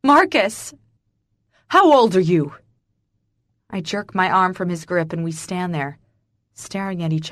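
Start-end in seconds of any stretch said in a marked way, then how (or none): 0.0-0.7 high, loud call; urgent yell for someone nearby; cry for help. A narrator's reading. She cries out for husband. 1.7-2.5 loud, forceful question; agitated, insistent shout; shouted demand. A narrator's reading. Father demands her age.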